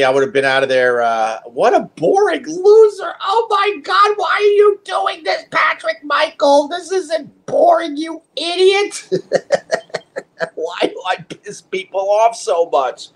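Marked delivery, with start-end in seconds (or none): 1.4-9.1 very high, shouted mock-hater voice; mock hater rant. Mocking an angry viewer's rant. 9.1-13.1 talking through real laughter; laughing. Real, not acted. Laughing after his mock rant.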